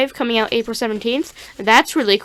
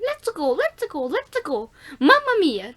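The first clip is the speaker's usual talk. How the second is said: Mario voice; 5 semitones higher than usual. Retold or imitated. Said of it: "they didn't wanna hear".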